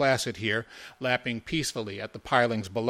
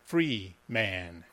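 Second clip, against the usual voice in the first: "Free man" low and deliberate, two distinct words; syllables separated; 3 semitones lower, 4 dB quieter than usual. A narrator's reading. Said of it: "pronounced the syllables separately, distinctly, stopping between them".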